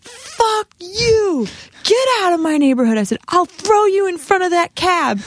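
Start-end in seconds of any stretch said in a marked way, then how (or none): 0.0-3.0 high, strained furious yell; sudden high-pitched outburst; retold losing it. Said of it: "just all of a sudden lost it on her". 3.3-5.2 high-pitched furious threat; re-enacted street-fight outburst; retold losing it. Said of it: "just all of a sudden lost it on her".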